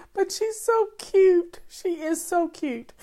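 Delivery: doting owner gushing about the puppy, high, slow coo. Real, not acted. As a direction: coo it like she's right in your lap. Cooing over her new puppy.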